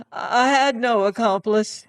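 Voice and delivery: high, loud denial; desperate cry. Acted. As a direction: cry out in fear. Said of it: "he cried".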